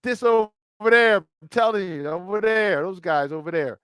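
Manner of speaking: light Chicago vowels on "over there"; Chicago accent. Shown as a demonstration. Host showing his Chicago accent to co-host, light Chicago accent. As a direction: lean into the Chicago "there" a little. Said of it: "speak without my Chicago accent".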